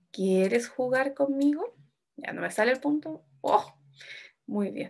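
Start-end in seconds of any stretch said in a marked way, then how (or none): 0.1-1.8 very slow question, rising on "conmigo"; tono de pregunta. Shown as a demonstration. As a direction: read it out slowly, lifting "conmigo". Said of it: "de pregunta". (pregunta muy lenta, "conmigo" sube al final)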